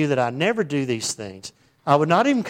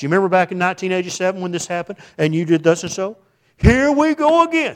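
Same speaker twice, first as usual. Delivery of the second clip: exasperated nag, stretching out here we go again; mock exasperated spouse; 5 semitones higher, 6 dB louder than usual. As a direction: drag up the past like a fed-up spouse. Spouse dragging up the past.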